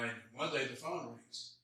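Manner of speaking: ordinary talk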